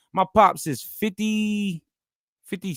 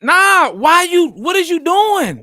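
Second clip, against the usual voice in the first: loud, high, drawn-out protest; incredulous shout; 8 semitones higher, 10 dB louder, slower than usual. Real, not acted. Son arguing about his father's choices, incredulous shout. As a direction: cry it out slowly in disbelief. Heated back-and-forth over his dad.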